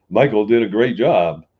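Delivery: mock public praise. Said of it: "putting an ad on Facebook saying".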